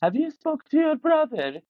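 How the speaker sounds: high-pitched mock-mum voice; impression of mum. Son mimicking his mum asking about his brother, high-pitched impression. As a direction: put on a fretting mother, pitched well up. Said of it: "that's my impression of it".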